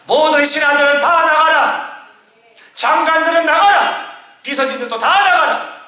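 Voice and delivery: high, ringing royal order; commanding king. Acted. King Hezekiah dismissing his officials, high commanding cry.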